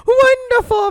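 high, loud gushing wonderful; loud gushing commentator impression; imitating Corey Graves. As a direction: swoon over it like an excited fan. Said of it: "He would too busy be going".

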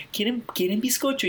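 high-pitched imitated waiter. Waiter voiced in story.

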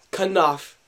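normal talking voice

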